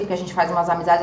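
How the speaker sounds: ordinary delivery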